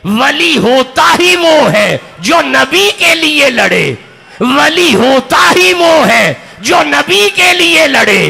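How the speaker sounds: loud, rhythmic sermon with each line hammered home; rousing chanted oration; fiery preaching. Acted. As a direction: preach it at full force to a packed crowd. Full-force preaching to the crowd.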